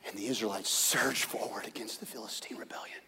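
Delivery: hushed dramatic whisper; whispered narration. A narrator's reading. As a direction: tell it in a tense whisper.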